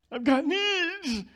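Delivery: high, slow, put-on whine of someone in need; mock needy voice. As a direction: act out a needy little voice. Preacher voicing a needy self.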